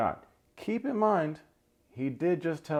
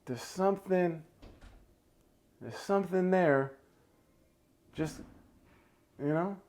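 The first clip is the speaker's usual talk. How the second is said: teasing hint; 8 semitones higher, 4 dB quieter than usual. Real, not acted. Teasing a hidden romance.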